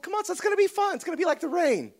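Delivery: put-on high, cheery sales pitch to a small child; upbeat coaxing dad. Dad coaxing son into shower.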